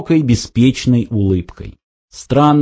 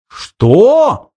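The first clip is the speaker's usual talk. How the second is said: high, astonished outcry; crying out; 9 semitones higher than usual. A narrator's reading. Ford hears his cousin's alias, crying out. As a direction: cry it out in disbelief. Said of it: "вскричал Форд".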